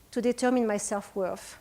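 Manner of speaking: usual speaking voice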